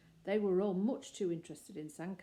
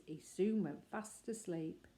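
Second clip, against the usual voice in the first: usual speaking voice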